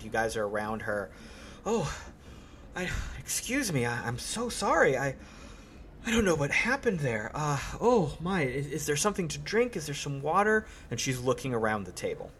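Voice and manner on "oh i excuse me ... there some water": higher, hesitant lady's voice; dazed, halting and apologetic; lady coming round. Acted. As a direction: apologise faintly, as if just recovering from a faint. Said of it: "the lady comes back to herself".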